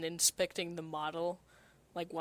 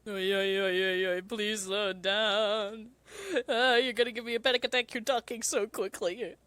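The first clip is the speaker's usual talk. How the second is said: Miku voice; 2 semitones higher, 4 dB louder than usual. Acted. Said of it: "in Miku's voice".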